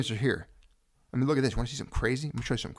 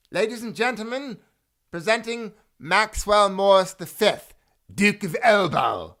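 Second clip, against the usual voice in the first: high, loud and slow, heralding a duke; royal court announcer; 7 semitones higher, 8 dB louder, slower than usual. Acted. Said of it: "you would have to say it like".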